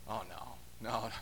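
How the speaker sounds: a hushed, low, comic "oh no"; mock dread. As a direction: sink into it like you're in trouble. Listener dreading Jesus' story.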